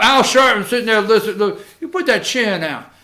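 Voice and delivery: ordinary talk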